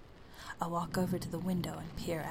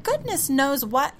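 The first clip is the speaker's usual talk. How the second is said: high, loud snapped retort; snapping ceiling voice; 8 semitones higher, 11 dB louder than usual. Acted. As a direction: fire the words back at her. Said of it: "His next sentence flies out".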